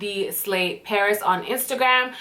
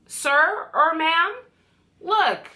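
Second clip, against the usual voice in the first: sassy address; 7 semitones higher, much slower than usual. Real, not acted. Scolding broken bottle.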